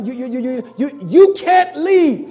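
stuttering, rising to a loud drawn-out end; a hint of a menacing stammering man; stammering cashier. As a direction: stammer it out, then push hard. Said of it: "the man began to stammer and stammer".

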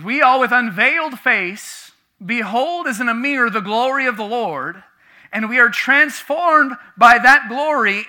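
loud, high, emphatic sermon delivery; preaching. Real, not acted. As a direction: preach it out with conviction. Declaims scripture in preaching.

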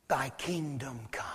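hushed whisper, low and reverent. Real, not acted. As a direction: drop to a whisper to land the point. Preacher hushes the key phrase.

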